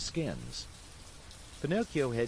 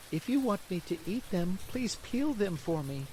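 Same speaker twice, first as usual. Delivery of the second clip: put-on high childish puppet voice; a fussy boy asking for peeled pears; high, whiny request; Pinocchio's voice; 10 semitones higher than usual. Pinocchio asks Geppetto.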